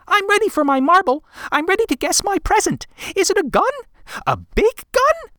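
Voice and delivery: put-on high, fast eager boy; a kid bursting to guess his present; excited child voice. A narrator's reading.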